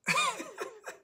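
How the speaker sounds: sudden high burst of laughter at ourselves; exasperated laugh. Real, not acted. Exasperated at everyone's bad habits.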